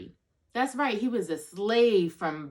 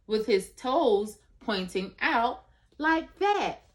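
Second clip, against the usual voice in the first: slow, higher, playful demonstration; reading to a toddler; 3 semitones higher, slower than usual. Acted. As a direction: show the little one how he walked. Demonstrating the walk.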